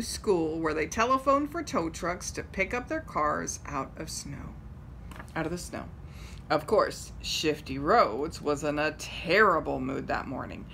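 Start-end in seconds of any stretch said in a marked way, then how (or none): none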